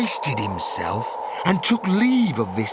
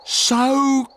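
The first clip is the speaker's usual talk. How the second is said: high, louder call across a distance; urgent call, pitched up; 3 dB louder than usual. Acted. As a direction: call out to the child below. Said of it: "calls the Onceler".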